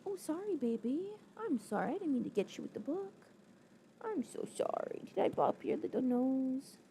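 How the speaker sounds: tender pet-talk apology. Real, not acted. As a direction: coo sorry to the little one. Soothing pet hit by book.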